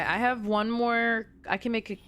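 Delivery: ordinary delivery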